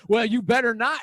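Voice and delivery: high, loud mock scolding; retold warning. Lady warning the newcomer off the line, imitated scolding.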